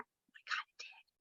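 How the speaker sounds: unvoiced exasperated whisper; hushed eye-rolling aside. Real, not acted. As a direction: breathe it out to the listeners. Whispered aside after quote.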